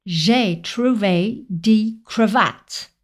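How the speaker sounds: put-on very slow English-accented French, last word as creu-VATTE; laboured English accent, each French word separated, punch final VATTE; anglophone learner's accent. Pupil's last attempt with the teacher, slow English-accented French. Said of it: "mon élève a fait une dernière tentative".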